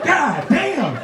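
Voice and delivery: high, indignant ranting; comically fed up, pitched well up; mock anger. Cheated churchgoer after throwing the donut, high mock anger. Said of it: "I'm mad".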